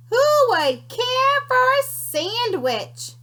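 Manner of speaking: high, slow sing-song call from the distance; faint far-off call. Acted. Unseen creature calling to the scouts, high and slow. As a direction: lure them in from far away.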